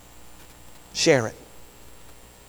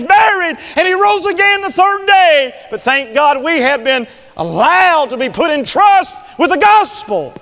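full-force preaching; 12 semitones higher, 7 dB louder than usual. Real, not acted. Preacher urging the congregation to share the gospel, full-force preaching. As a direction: build to a high, loud preaching shout.

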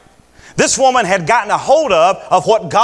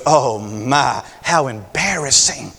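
prissy, softer disdain; mock embarrassed Pharisee; 6 semitones lower, 3 dB quieter, slower than usual. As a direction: sniff it out like a snob. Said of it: "he goes".

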